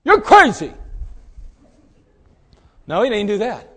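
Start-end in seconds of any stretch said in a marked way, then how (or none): 0.0-0.7 mock insult. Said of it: "He didn't even go away going".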